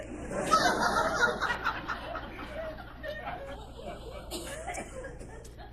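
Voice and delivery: long high falsetto cackle; high laugh. Real, not acted. Comedian cracks up at his own punchline, high laugh. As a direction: let a high laugh roll out and fall away.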